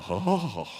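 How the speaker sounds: usual speaking voice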